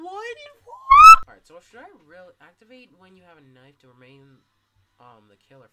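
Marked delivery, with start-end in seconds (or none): 0.0-1.3 high shocked yell; shocked shout. Real, not acted. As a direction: shout it in disbelief. Sudden shock in game.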